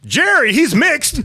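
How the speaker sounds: high, loud yell from the audience; drawn-out shout from the back; heckler calling out. Retold or imitated. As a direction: holler it slowly across the room. Audience member yells out, retold.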